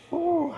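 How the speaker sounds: sharp high yelp of effort; high strained ooh. Real, not acted. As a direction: squeak out the strain. Groan during leg exercise.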